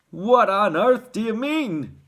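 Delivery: loud, high, drawn-out demand; alarmed father's sharp question. A narrator's reading. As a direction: demand it sharply, leaning on each word. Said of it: "asked Mister T V sharply".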